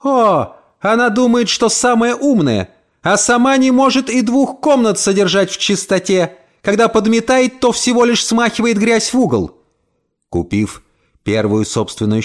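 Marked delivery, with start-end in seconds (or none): 0.0-9.5 put-on high, indignant scolding old woman; angry old landlady. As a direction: sneer at the tenant in a shrill-pitched huff. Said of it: "она слегка взбесилась". (злобное ворчание старухи, голос выше)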